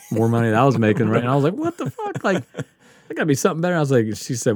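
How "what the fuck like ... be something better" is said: retold disbelief. Retold frustration at low pay.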